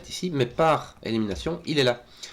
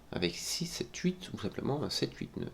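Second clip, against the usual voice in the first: whispered counting; 3 semitones higher, 10 dB quieter than usual. Real, not acted. Counting options under breath.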